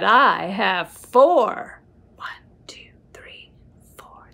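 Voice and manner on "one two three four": soft breathy count under the breath; whispered counting. Acted. Reading to a child, counting apples in a whisper. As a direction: count quietly like a secret.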